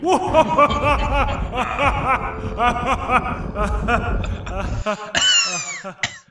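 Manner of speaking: loud villain laugh; evil cackle. Acted. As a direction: cackle like a horror host. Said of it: "we're acting like children".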